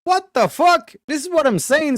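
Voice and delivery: high, loud incredulous outburst; can't believe what he's hearing; shocked disbelief. Real, not acted. Outraged disbelief at caller.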